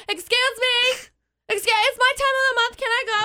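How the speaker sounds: high, put-on timid schoolkid voice; mock middle schooler. Middle schooler asking teacher in front of class, mock little voice. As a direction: imitate a kid piping up with an awkward request.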